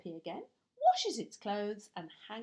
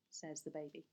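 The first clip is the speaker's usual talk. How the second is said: ordinary delivery, nothing marked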